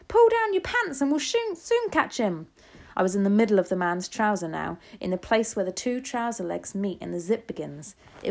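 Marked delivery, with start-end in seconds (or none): 0.1-2.5 high, loud urging; shouting. A narrator's reading. Kitchen worker offering to catch the mouse, shouting. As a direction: call out the plan in a high shout. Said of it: "someone else shouted".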